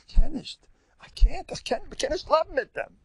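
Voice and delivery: high, loud mock whine of a fed-up spouse; hammed-up wail of I can't; whiny complainer. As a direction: imitate someone who can't take it anymore. Mimicking complaining congregant.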